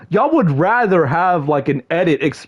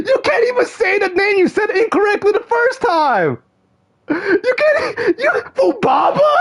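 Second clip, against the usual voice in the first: high, whiny yelling; exasperated shout; 13 semitones higher than usual. Real, not acted. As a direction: rant in disbelief. Frustrated rant at the mistake.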